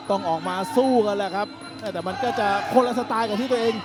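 usual speaking voice

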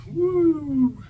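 high, sudden woo of delight; gleeful cheer as the other gondola goes by; excited whoop. Real, not acted. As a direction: let out a thrilled yelp. Gondola passes, spontaneous cheer.

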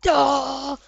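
loud sudden yelp; shocked cry. Real, not acted. Player caught off guard by a hacker, loud yelp.

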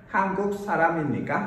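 한국 사람입니까 run together fast, with the k linking into 사람; fast, linked. Shown as a demonstration. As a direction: rattle it off quickly as one word. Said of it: "đọc nhanh nối âm sao". (đọc nhanh, nối âm liền mạch)

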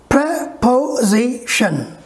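Preposition very slow, pre, po, zi, tion; syllable by syllable. Shown as a demonstration. Teacher asking how many strokes, very slow segmented word. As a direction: split Preposition into four spaced beats. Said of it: "Four strokes".

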